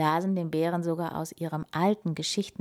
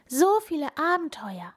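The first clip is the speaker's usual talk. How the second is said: high-pitched wonder; awed delight, voice lifted; amazed; 9 semitones higher than usual. A narrator's reading. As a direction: marvel like an astonished hare. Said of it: "staunte der Hase".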